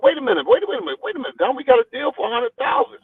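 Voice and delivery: rising disbelief, voice shooting up; shocked protest. Retold or imitated. Boxer protesting a short paycheck to the promoter, rising disbelief.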